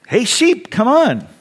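lightly voiced youth pastor calling out; eager young pastor. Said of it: "the young seminary, you know, youth pastor says".

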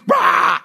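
sudden loud "rah" outburst; explosive roar. Acted. Preacher acting a parent who suddenly explodes, mock roar. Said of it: "then you explode".

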